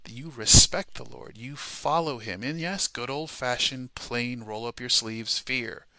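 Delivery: ordinary talk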